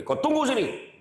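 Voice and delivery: hammed-up husband ordering his wife to wait; angry order. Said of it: "Dia marah bini".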